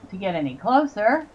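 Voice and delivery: usual speaking voice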